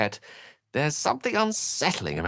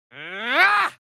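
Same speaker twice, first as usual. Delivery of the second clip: sharp cry; 6 dB louder than usual. Acted. Sudden pained cry.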